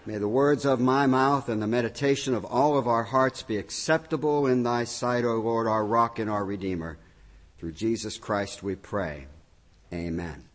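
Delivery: low, softening prayer voice. Real, not acted. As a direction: pray it reverently, fading at the end. Spoken prayer.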